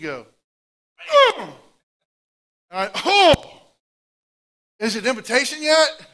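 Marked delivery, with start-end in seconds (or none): 1.1-1.6 sudden high, loud "Oh!"; startled gasp. Retold or imitated. Wife jabbing him awake in the pew, high loud yelp. 3.1-6.1 high, loud jolted question; startled awake. Retold or imitated. Dozing man jolted awake in church, loud and high.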